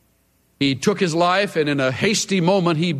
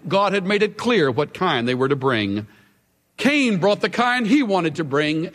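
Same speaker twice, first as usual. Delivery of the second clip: usual speaking voice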